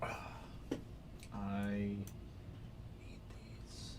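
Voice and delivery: breathless whisper to himself. Real, not acted. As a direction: whisper it like a secret craving. Craving whispered while eating.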